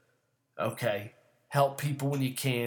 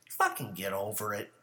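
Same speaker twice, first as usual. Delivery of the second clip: ordinary talk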